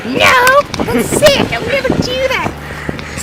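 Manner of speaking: very high, put-on little boy voice; indignant kid. Accused cousin denies it, in a high indignant kid voice. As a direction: squeal it like an offended kid.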